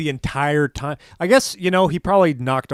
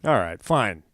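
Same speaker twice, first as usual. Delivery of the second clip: usual speaking voice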